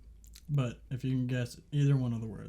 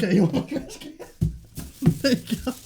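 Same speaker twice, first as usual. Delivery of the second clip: laughing; 10 semitones higher, 7 dB louder, faster than usual. Real, not acted. Laughing while handing trash can.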